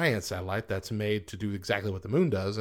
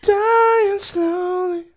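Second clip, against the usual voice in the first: mock dramatic; 10 dB louder, much slower than usual.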